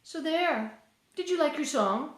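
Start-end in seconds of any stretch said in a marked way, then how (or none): none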